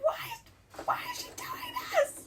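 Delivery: unvoiced high shocked cry of disbelief; whisper-shout like you can't believe the plot. To mom watching the show, a whispered shocked outcry. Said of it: "We're like".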